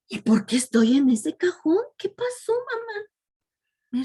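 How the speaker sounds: put-on high, puzzled questions like a waking boy; confused young man. Son asks his weeping mother why, high and puzzled. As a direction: plead up high, not understanding the tears. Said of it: "se preguntaba".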